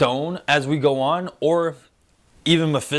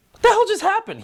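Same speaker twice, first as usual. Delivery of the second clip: very high-pitched incredulous question; hammed-up disbelief; mock bewilderment; 3 dB louder, faster than usual. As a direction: imitate a baffled husband, voice shooting up. Acted baffled reaction as Vision.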